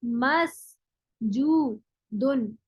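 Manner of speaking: मसजूदुन stretched very slowly, syllable by syllable; slow drawn-out demo. Shown as a demonstration. Teacher spelling out मसजूदुन for students, very slow. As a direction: draw मसजूदुन out slowly so students catch each sound. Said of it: "और इसका क्या हो जाएगा".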